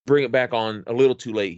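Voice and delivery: usual speaking voice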